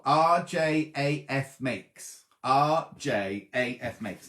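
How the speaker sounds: R J A F Makes spelt out letter by letter, very slow; slow dictation. Shown as a demonstration. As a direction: dictate each letter slowly so viewers can type it. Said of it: "slowly dictating shop name".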